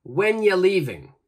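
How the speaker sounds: "When ya leaving?" with "you" cut to a quick "ya"; casual "ya" demo; reduced "ya". Shown as a demonstration. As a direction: run "When ya" together. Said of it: "I'll give you the correct pronunciation".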